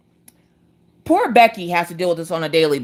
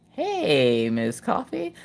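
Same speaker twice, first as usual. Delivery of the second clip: wary greeting; 3 semitones lower, 7 dB quieter, much slower than usual. Retold or imitated. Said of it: "She's just like".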